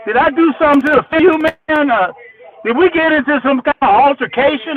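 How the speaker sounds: high, fast, mock-innocent question; innocent Greg. Hungover Greg asking Tony what happened, high mock innocence. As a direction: play dumb, all wide-eyed and quick. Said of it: "He said".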